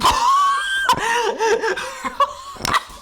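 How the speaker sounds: high, squealing burst of laughter; shrieking laugh. Real, not acted. Streamer cracking up at a chat message, shrieking laugh. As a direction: let a shrieking laugh explode out.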